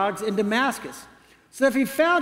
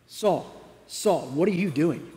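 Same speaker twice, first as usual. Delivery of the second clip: voice from heaven; 4 semitones lower, 3 dB quieter than usual. Acted. Voice of Jesus from heaven.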